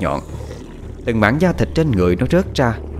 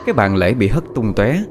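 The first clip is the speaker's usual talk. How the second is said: plain reading voice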